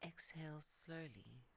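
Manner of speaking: usual speaking voice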